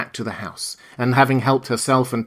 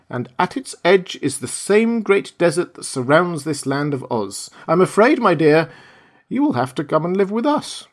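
put-on lightly raised, gentle old lady voice; kindly old witch; 7 semitones higher than usual. Witch of the North tells Dorothy she must stay, lightly voiced kindly old woman. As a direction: break the news kindly, like a grandmother.

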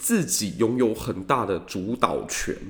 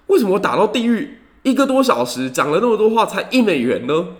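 outraged disbelief; 8 semitones higher, 5 dB louder than usual. Retold or imitated. Khrushchev shocked at phone bill.

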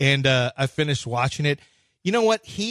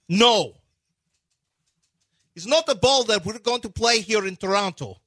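put-on higher, halting Russian-accented complaint; Darko; 3 semitones higher than usual. Coach complaining about the defense in Toronto, high mock Russian accent. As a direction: grumble in broken English with a thick Slavic accent. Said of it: "Your Darko impression is".

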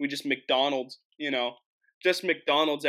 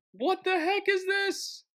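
very high, drawn-out disbelief; baffled dismay; slower than usual. Real, not acted. Host baffled by interruption mid-show, high squeal. Phone call cuts the video.

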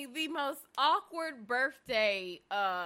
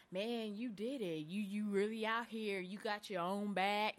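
low, soft imitation of a man's accent; attempted T.I. accent; 4 semitones lower, 7 dB quieter than usual. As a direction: try his accent, quietly and lower. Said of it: "Well, I can't talk like".

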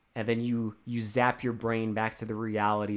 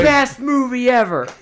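high, very loud rave; full-volume mock cheer; imagined screaming; 13 semitones higher, 10 dB louder than usual. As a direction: yell it like an ecstatic fan in a theater. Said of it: "like, screaming".

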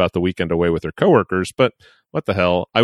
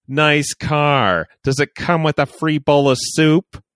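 mocking taunt; 7 semitones higher, slower than usual. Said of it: "he said loudly for all to hear".

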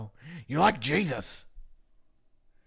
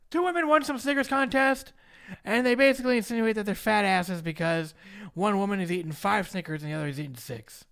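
ordinary talk

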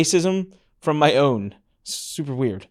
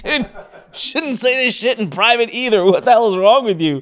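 laughing disbelief; 8 semitones higher, 4 dB louder than usual. Retold or imitated. Exasperated reply to racist client.

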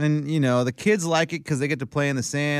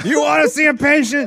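high, loud mock challenge; a joking dare to the nurse; mock threatening yell; 13 semitones higher, 7 dB louder than usual. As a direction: shout it as a playful threat.

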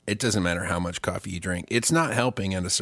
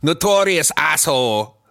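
mock German accent, higher and louder; 6 semitones higher, 5 dB louder than usual. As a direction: say it like a German hip hop star. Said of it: "I just say it with a German accent".

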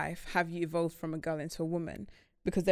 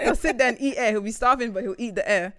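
laughing; 4 semitones higher, 7 dB louder than usual. Retold or imitated. Mocking the husband, laughing.

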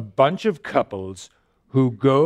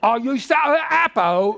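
higher, mock British accent; 7 semitones higher than usual. Hammed-up British accent playing a shopper. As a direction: ham up a British market voice. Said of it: "if you spoke with a British accent".

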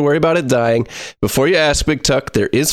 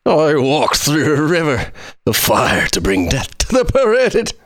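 slow, eerie ghoul-man voice; Niv Mizzet ghoul voice; slower than usual. Acted. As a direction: read it like a creepy ghoul. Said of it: "who sounds like a ghoul man".

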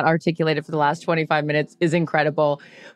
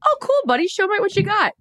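much higher, gushing mimicry of an eager husband; high-pitched star-struck buddy voice; 13 semitones higher than usual. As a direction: play the wide-eyed sweet guy. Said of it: "is like".

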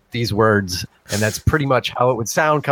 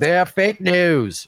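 drawn-out, higher-pitched mock slogan; pitched-up, stretched-out catchphrase delivery; mock proclamation; 5 semitones higher, slower than usual. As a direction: proclaim it slowly, stretching the words. Mock slogan after talk about fake.

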